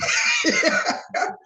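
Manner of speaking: breathy high laugh. Real, not acted. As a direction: burst out laughing. Laugh after his reply.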